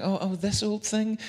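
high, put-on coy dismissal; faux humility. Someone dismisses praise of her dress, high coy faux modesty. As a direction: brush off the compliment with fake modesty. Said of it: "and you're like".

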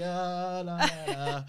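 high, loud "la la" babble; mock loud praising. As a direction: belt out wordless praise as a cover. Mimics loud praising to avoid stranger.

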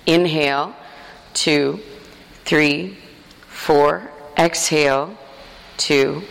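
measured count with a pause after each number; steady, spaced-out yoga counting; breath count. Shown as a demonstration. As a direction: pace slow breathing, stretching each word evenly. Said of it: "I'm gonna count to four in both directions".

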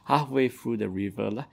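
normal talking voice

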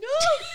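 high sudden laugh; burst of laughter. Real, not acted. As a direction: laugh out in disbelief. Laughs at the proposal.

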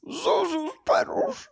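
high, slow, drawn-out wail; hammed-up weepy joy; mock-tearful George. Third host mimics the same line.

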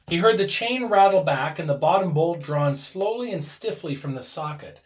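ordinary delivery, nothing marked

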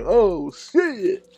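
high-pitched, drawn-out exclamation; awed excitement. Retold or imitated. Listener reacting to a favorite love song, awed excitement. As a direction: react as if blown away.

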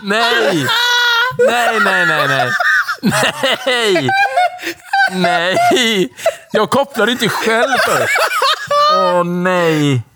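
high-pitched words through real laughing fits; laughing so hard it nearly turns to crying; helpless laughter. Real, not acted. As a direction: protest while cracking up. Laughing helplessly at own slip.

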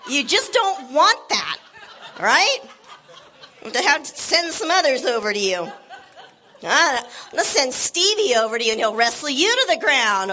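ordinary delivery

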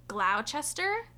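Gloucester as "glau-chester", drawn out and rising; spelled-out pronunciation. Shown as a demonstration. Host unsure of the town name, spelled-out pronunciation. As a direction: slowly sound out Gloucester as written, questioning.

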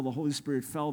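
ordinary talk

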